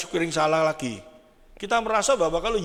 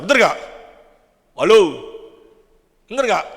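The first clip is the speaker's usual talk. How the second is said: loud call to the crowd, demanding an answer; a loud call for a response from the pews; 2 semitones higher, 10 dB louder than usual. Real, not acted. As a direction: shout it out to wake the room up. Calling out to congregation.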